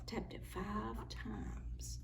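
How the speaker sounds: hushed whisper aside; soft breathy aside. Real, not acted. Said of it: "whispering to the child".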